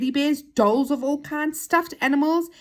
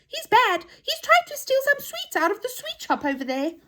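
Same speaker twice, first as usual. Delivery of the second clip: high-pitched accusing toy voice; indignant toy. Acted. Toy accuses Saucepan of stealing sweets, high and indignant. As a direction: tell tales in a high, indignant voice.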